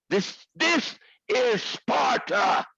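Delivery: slow, drawn-out epic movie line; movie quote. Retold or imitated. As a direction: boom it out like King Leonidas. Quoting the movie 300 line.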